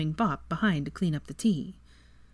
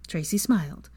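plain reading voice

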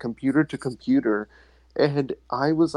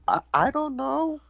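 put-on high-pitched little kid, rising at the end; clueless kid. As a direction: squeak it out like a lost child.

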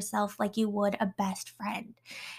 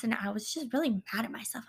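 ordinary talk, nothing marked

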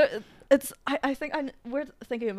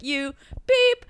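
angry yell at drivers; 9 semitones higher, 8 dB louder than usual. Retold or imitated. Yelling at old drivers.

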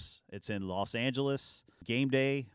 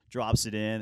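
ordinary delivery

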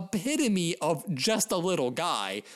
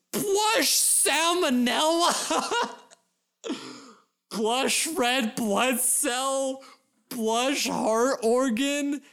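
giggling through the list; higher, slow amused listing; laughing; 4 semitones higher, slower than usual. Real, not acted. Laughs while listing plushies.

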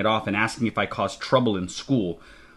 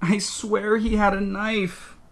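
high, slow, pleading insistence; last protest; 10 semitones higher, slower than usual. A narrator's reading. Child makes one last protest to Dad, pleading. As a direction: plead it small and high, drawing it out. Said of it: "One last protest".

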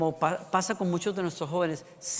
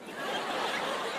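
real burst of laughter; breathy laugh; 9 dB quieter than usual. Real, not acted. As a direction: laugh out before the line. Nurse's reply cracks him up. (risa real)

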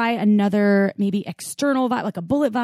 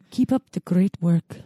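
ordinary delivery

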